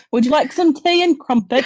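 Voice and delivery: put-on British accent. Puts on British accent, accent week.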